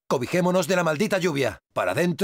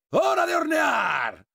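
high, drawn-out shout; war cry; 9 semitones higher, slower than usual. Acted. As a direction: roar it out slowly as you open fire. Battle cry with flamethrower.

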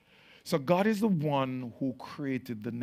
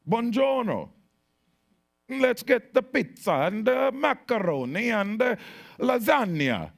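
hammed-up Italian accent, higher and louder; over-the-top mock Italian accent, much higher; 10 semitones higher, 7 dB louder than usual. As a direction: play a cartoon Italian ordering food. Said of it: "That's an accent?".

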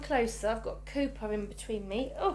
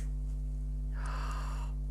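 soft breathy unvoiced gasp; a hushed intake of wonder; 6 dB quieter than usual. Real, not acted. Seeing the dummy.

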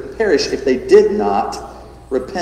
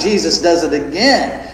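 ordinary talk, nothing marked

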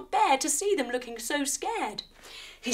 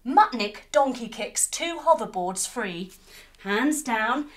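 plain reading voice

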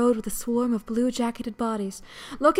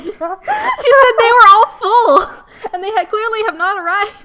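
laughing high voice; 10 semitones higher, 11 dB louder than usual. Real, not acted. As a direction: squeal it while laughing. Laughing high-pitched complaint.

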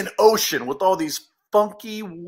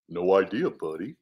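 bear voice; 12 semitones lower than usual. Acted. Bear's first reply.

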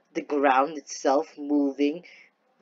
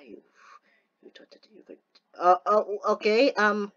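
ordinary delivery

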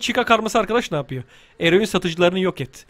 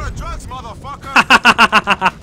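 long laugh after an explosion; gleeful laugh. Real, not acted. Player after blowing up enemies, gleeful laugh. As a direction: laugh at the havoc you made.

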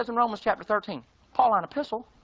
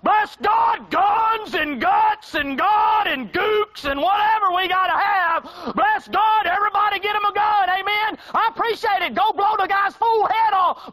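mock patriot preacher; 11 semitones higher, 6 dB louder, slower than usual. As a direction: shout it like a gun-crazy preacher you're mocking. Mocking gun-loving patriot preachers.

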